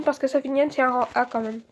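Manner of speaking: ordinary delivery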